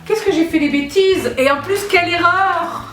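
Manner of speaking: high, loud cry of oops; calling out to her viewers in dismay; excited dismay. Real, not acted. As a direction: shriek at her own mistake. Realises she skipped box eleven.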